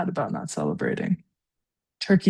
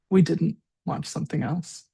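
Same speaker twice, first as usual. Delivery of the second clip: plain reading voice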